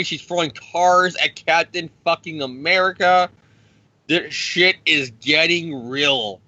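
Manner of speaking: usual speaking voice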